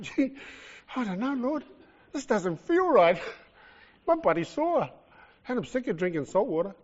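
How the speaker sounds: a hint of a sore, doubting sufferer; imagined doubting Paul. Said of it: "say to yourself".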